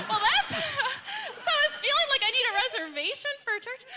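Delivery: high, loud laughing through tears. Real, not acted. Speaker admitting to the church how she felt, laughing tearfully. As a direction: laugh it off, a little choked up. Laughs while retelling her feeling.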